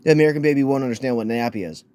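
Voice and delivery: ordinary delivery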